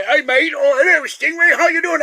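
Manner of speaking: hammed-up Australian, cheery and loud; Australian accent. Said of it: "that Australian guy".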